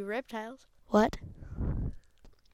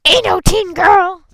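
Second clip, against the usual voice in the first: loud playful shout; 8 semitones higher, 12 dB louder than usual. Pretend. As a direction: yell it out. Silly shouted line.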